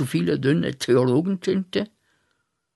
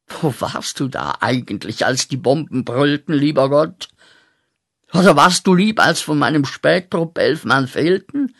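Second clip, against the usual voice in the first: wütende Anklage; 2 semitones higher, 5 dB louder than usual. Acted. Anklage gegen Gott.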